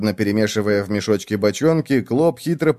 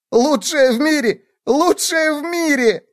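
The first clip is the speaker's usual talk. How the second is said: very high squealed echo; high-pitched hysterical bug mockery; hilarious mocking repetition. A narrator's reading. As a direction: squeal it back in derision, rising higher. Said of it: "заикаясь, повторял он, растирая по лицу выступившие слёзы".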